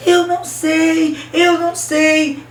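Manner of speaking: high, slow, loud acted wail; tearful wail. A narrator's reading. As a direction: protest slowly in a mock-crying high voice. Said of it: "chorava tanto, soluçava e dizia". (lamento choroso encenado, agudo e lento)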